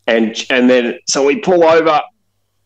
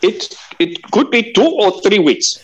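a light put-on country mechanic accent, raised in pitch; a hint of an outback mechanic accent; mechanic's accent; 10 semitones higher than usual. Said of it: "in the accent, he said".

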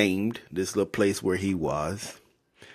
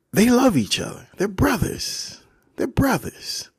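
mock gushing; 12 semitones higher than usual. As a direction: gush over the brothers, pitched way up. Gushing over the brothers' reunion.

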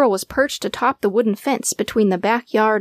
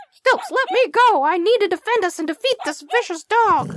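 high, urgent little dog straining to fight; an agitated small dog's plea; frantic; 11 semitones higher than usual. A narrator's reading. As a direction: yap it out desperately, pulling at the leash. Dog straining against leash.

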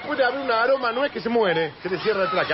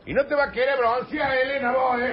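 nagging parent; 4 semitones higher than usual. Acted. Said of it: "No entiendo por qué seguís gritando".

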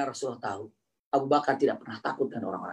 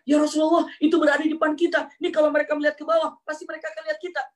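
high, louder hurried warning; alarmed Abu Bakar; 12 semitones higher, 4 dB louder, faster than usual. Acted. Abu Bakar urging the Prophet, high and louder. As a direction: raise the voice in alarm.

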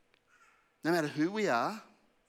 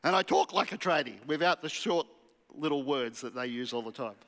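ordinary talk

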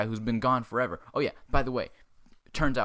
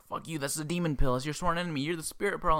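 higher-pitched hammed-up telling-off; mock angry scolding; 6 semitones higher than usual. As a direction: scold him in a high, mock-outraged voice. Acted quarrel, dragon scolds friend.

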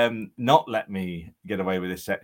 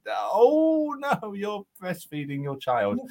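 high, slow gasp of embarrassment; hammed-up shocked apology; mock horrified realisation; 9 semitones higher, slower than usual. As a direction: recoil in slow dismay.

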